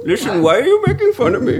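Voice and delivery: hammed-up Indian accent, teasing; mock Indian accent. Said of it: "we're going back to the Indian accent".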